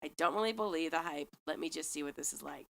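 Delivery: ordinary talk, nothing marked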